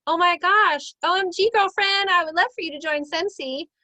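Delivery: high, loud, bubbly gushing; gushing girlfriend invite. Shown as a demonstration. Recruiter showing how to hype an invite, high, loud and gushing. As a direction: squeal it like inviting your bestie. Demoing an enthusiastic invite message.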